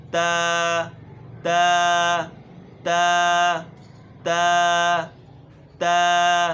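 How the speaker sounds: تا loud, tongue tip on the gum ridge; recitation of ta. Shown as a demonstration. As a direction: repeat تا crisply. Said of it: "اب چلتے ہیں تا کی طرف". (تا کی صاف ادائیگی)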